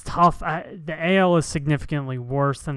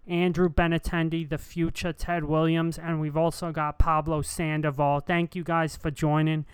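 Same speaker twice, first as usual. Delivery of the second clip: Boston sports radio host. Pretend. Live from Fenway, a mild Boston broadcaster voice. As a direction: hype it up like a Boston call-in host. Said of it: "that's a really good impression".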